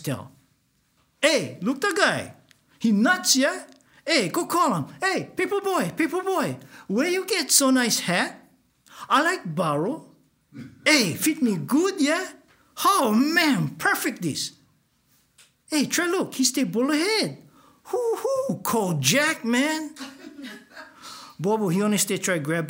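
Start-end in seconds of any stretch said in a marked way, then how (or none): 1.2-19.9 1 mocking teasers. Mooks taunting Bobo from van. 20.0-21.3 1 mocking laugh. Mooks laughing at Bobo.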